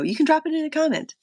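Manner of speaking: high, fast, bright sing-song; chirpy call-out. Pretend. Streamer urging live viewers to comment, high and quick. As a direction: pitch it up and rush it like a cheerful plug.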